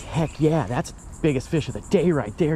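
ordinary talk, nothing marked

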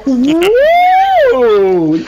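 high, slow, hammed-up fake excitement; mock surprised greeting. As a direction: squeal it like a fake welcome. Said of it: "He's like".

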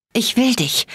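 high, strained croak. A narrator's reading. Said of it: "krächzte ich".

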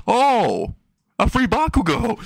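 high, drawn-out delighted "oh"; acted high-pitched glee; gleeful delight. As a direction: pounce on a lucky find. Hisoka delighted at finding Bakugo.

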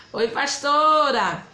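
ordinary talk, nothing marked

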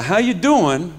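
high, loud Bronx-accented greeting; punchy New York acknowledgement. Shown as a demonstration. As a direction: demonstrate the Bronx catchphrase. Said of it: "is not a question".

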